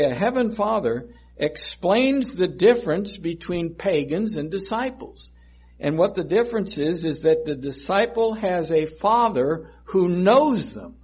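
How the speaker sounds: rising sermon climax; full-force preaching. Acted. Preacher quotes and presses on.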